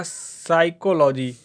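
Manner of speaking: साइकोलॉजी spelled out slowly, sigh-ko-lo-jee; careful pronunciation demo. Shown as a demonstration. Teacher showing students how to say it, slow and careful. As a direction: say साइकोलॉजी slowly and clearly, syllable by syllable. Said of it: "मैं इसका pronunciation करके आपको दिखा देता हूँ".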